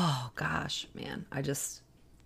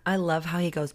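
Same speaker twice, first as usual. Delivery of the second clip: ordinary talk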